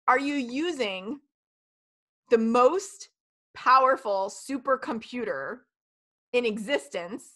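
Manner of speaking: slow, each phrase set apart by pauses; slow emphatic challenge. Shown as a demonstration. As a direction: let every question land with a pause. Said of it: "rhetorical challenge".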